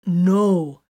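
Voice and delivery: a sudden loud burst of No. A narrator's reading. Titus snapping at Vox, loud sudden outburst. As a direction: blurt it out hard and loud. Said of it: "Titus burst out".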